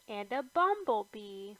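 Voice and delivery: ordinary delivery, nothing marked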